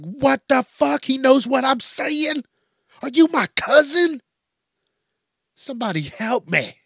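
alarmed stranger begging for help; mock panic. Aliens confused and panicking at the stranger.

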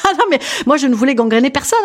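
ordinary talk

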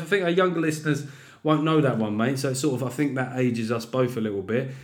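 normal talking voice

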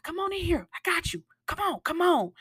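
high-pitched urgent beckoning; imitated wheelchair guy. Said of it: "wheelchair guy was like".

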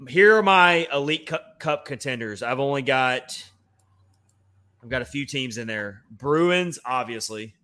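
ordinary delivery

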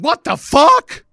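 high, loud, drawn-out reenacted outburst; livid. Fan watching his team down seventeen nothing, livid. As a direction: yell it in outraged disbelief. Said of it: "I'm livid".

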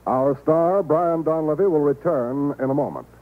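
ordinary talk, nothing marked

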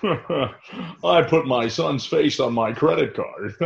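slow put-on voice with a laugh; hammed-up commercial dad; mock ad voice. Dubbing an ad character.

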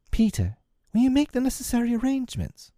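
high-pitched, sharp request; rather sharp. A narrator's reading. Wendy forgetting Peter's feelings, rather sharp and high. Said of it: "she said to him rather sharply".

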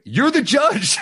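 high, loud disbelieving comeback; incredulous retort. Retold or imitated. Comic to judge Howie, a loud incredulous retort.

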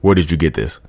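low, guarded question after a pause; mock a cornered cheater asking quietly; understated caught-out questioning. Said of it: "the pause of being caught".

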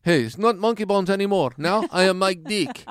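high, loud broken-English boast; mock foreign accent. Foreign guy boasts of his new name in a high mock accent.

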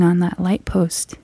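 eerie hushed reveal; whisper. Real, not acted. Narrator revealing the stranger at the post, whispering. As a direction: drop to a whisper for the scary part.

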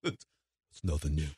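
mock Rambo growl. Repeating Rambo line mockingly.

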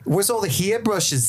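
high mock Australian accent, drawn out slowly; playful put-on Australian accent asking for hair brushes. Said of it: "you're doing an Australian accent".

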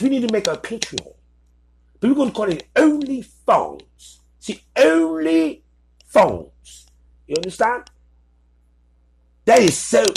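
hammed-up bad English accent as the posh tech founders; mock English accent, half-hearted but committed; terrible English accent. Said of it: "I'm sorry for the terrible English accent".

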